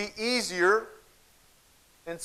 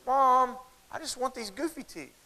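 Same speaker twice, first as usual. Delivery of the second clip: put-on higher pleading child voice, fading soft at the end; whiny little girl; 6 semitones higher, 4 dB quieter than usual. Little girl begging her mom for goofy teeth, whiny and high. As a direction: whine it up like a kid begging for a toy. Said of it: "the little girl kind of whines back at her".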